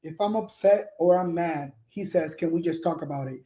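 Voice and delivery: undramatic imitation. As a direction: imitate her calmly, without drama. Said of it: "Look how she said it".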